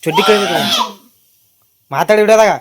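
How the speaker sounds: sharp loud call across the room. Real, not acted. Mother calling to child.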